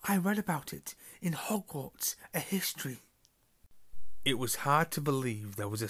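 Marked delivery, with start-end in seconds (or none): 0.0-3.0 high, breathy know-it-all whisper. Acted. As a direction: whisper the fact eagerly. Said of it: "He heard Hermione whisper".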